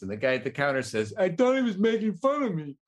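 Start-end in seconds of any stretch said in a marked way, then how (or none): 1.2-2.8 clerk with speech impediment. Acted. Clerk's punchline, same impediment.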